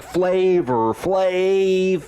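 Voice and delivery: drawn-out, high-pitched catchphrase holler. Retold or imitated. Host shows friends how the rapper says it, stretched-out impression. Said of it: "[name] says it like".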